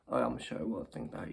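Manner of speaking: ordinary delivery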